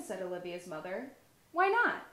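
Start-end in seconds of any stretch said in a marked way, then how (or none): 1.5-2.1 high, loud why not; indignant child. Acted. Olivia challenging her mother, high and indignant. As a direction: protest like a puzzled little girl.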